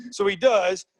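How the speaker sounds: ordinary delivery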